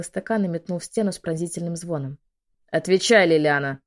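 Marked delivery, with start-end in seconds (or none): 2.7-3.8 loud booming command; thundering shout. Acted. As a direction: roar the name out. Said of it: "прогрохотал Асгард".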